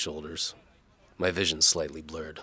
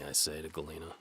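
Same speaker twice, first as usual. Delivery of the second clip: usual speaking voice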